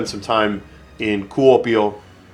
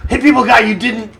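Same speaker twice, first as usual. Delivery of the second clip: gasp, then high squealing delight; high-pitched giddy cheer at the screen; excited squeal; 12 semitones higher, 4 dB louder than usual. Real, not acted. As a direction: squeak it out, thrilled your guy made it. Cheering on his game unit.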